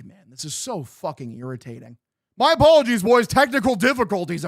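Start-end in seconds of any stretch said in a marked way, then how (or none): 2.4-4.5 high, loud overdone apology to the chat; mock formal apology. Streamer apologising to his viewers for the outage, mock formal. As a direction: play it like a grand announcement.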